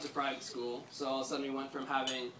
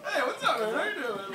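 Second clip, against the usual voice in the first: casual greeting; 8 semitones higher than usual. Retold or imitated. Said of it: "Jules was just sitting there".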